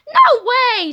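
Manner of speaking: high, loud whoop of disbelief; thrilled scream. A narrator's reading. Boy overcome with happiness, screaming high and loud. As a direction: belt it out, overjoyed. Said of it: "screamed George".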